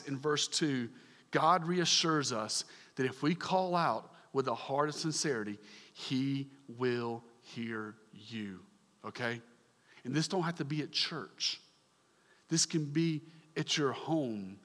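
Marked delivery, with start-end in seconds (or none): 5.9-8.6 HE WILL HEAR YOU slow and soft, word by word; measured reassurance. Real, not acted. Preacher assuring the congregation God hears, slow hushed emphasis on "he will hear you". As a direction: say "he will hear you" lower and quieter, spacing every word. Driving home God's reassurance.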